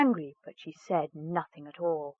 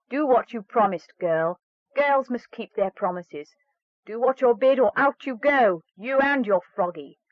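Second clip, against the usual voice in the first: louder, higher harsh threat; stepmother's threat; 4 semitones higher, 6 dB louder than usual. Acted. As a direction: threaten to throw her out.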